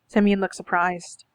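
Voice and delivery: usual speaking voice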